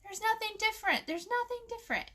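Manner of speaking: lightly voiced child sighing nothing's new; child's complaint. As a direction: mimic a bored kid reporting no change. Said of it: "she would say".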